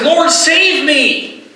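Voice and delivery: slow, high, pleading cry to the Lord. Acted. Peter sinking in the water, crying out high and slow. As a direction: stretch each word, begging to be saved. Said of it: "He cries out".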